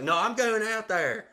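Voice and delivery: high-pitched, stubborn imitation of a wife; insistent wife. Wife overrules husband's plea to stay, high stubborn insistence.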